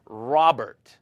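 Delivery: Robert with a trilled r at the start; rolled r. Shown as a demonstration. Teacher shows rolling the r on Robert. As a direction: roll the R of Robert.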